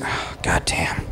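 breathless whisper, slowly; whispered curse. Retold or imitated. Father realizing he used the wrong scissors, whispered curse.

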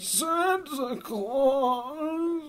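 high, very slow sing-song wail; ancient Martian voice. Retold or imitated. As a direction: stretch every word like an ancient oracle. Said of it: "that's exactly how he talks".

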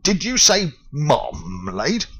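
slow, puzzled repeat; can't believe the word; incredulous foreman. Acted. The foreman questions Paddington, slow disbelief.

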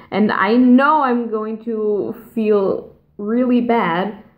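ordinary delivery

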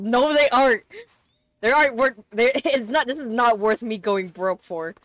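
loud, indignant protest; loud mock outrage with a laugh; indignant shout. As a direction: shout it down like the idea is absurd. Shouting back at own claim.

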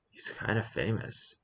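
hushed aside; whispering. Real, not acted. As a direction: whisper it to the audience.

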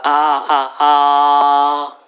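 "아" held high and level; first tone. Shown as a demonstration. Teacher demonstrating Chinese first tone to students. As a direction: hold "아" high and flat like Mandarin first tone. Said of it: "일성".

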